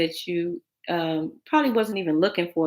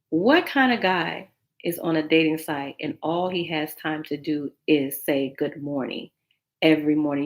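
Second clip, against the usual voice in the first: ordinary delivery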